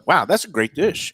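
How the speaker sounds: impressed. Real, not acted. Reacts to tasting the dish.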